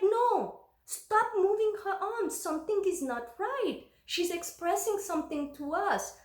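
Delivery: high-pitched, agitated retold plea; alarmed mother protesting, acted out; urgent protest. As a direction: insist on being heard, pitch way up.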